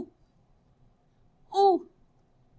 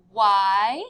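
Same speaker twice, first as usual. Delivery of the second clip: ordinary delivery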